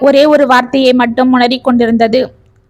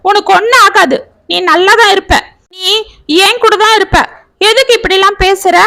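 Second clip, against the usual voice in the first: daughter voiced higher, pleading; comforting daughter; 7 semitones higher than usual. A narrator's reading. Daughter comforts her frightened father, higher pleading voice. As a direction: reassure him, a little raised.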